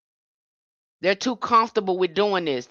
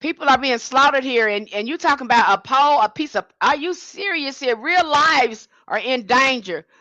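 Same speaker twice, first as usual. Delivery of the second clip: pitched high with disbelief, building heat; incredulous outrage; 6 semitones higher than usual. Real, not acted. To Democrats who want to wait for polls, outraged disbelief. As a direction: demand it, can't believe what you're hearing.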